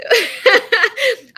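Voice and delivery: high, loud burst of laughter. Real, not acted. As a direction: break into a giggle. Laughs at own question.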